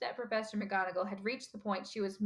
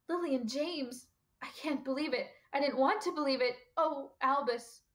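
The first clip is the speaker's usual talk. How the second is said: higher, distressed disbelief; stricken, pitch raised throughout; shocked grief; 6 semitones higher than usual. A narrator's reading. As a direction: lament in pained disbelief. Shocked grief at friends' deaths.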